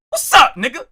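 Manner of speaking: ordinary delivery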